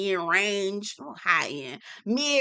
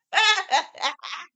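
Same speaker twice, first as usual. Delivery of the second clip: helpless giggle; high burst of laughter; 8 semitones higher than usual. Real, not acted. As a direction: crack up laughing. Laughing at the quote.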